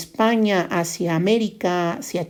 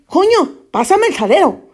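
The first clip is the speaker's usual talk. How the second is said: blunt Spaniard exclamation; 9 semitones higher than usual. Acted. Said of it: "en vez de decir".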